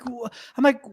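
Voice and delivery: usual speaking voice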